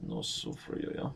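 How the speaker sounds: breathy whispered repeat; hushed whispered quote. Pretend. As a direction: whisper it to yourself.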